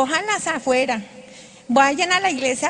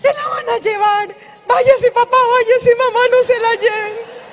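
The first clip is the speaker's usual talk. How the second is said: high, much louder frantic yelling; frightened shouting; 8 semitones higher, 7 dB louder than usual. Retold or imitated. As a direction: scream at them to go away, terrified. Frightened sister shouts at the ghosts.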